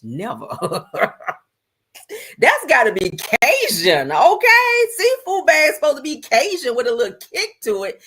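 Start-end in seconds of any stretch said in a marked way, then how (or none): 2.4-8.0 emphatic loud insistence. Real, not acted. Insisting on Cajun seafood.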